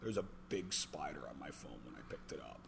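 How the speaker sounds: very quiet, low mumble; sheepish confession. Retold or imitated. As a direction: imitate a kid admitting it under his breath. Kid sheepishly admits the cause.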